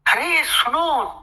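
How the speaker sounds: high, slow drawn-out shout; loud call. Acted. Jackal calling out loud to the forest animals. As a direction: call out slowly to the whole jungle. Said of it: "चिल्ला के बोलता है".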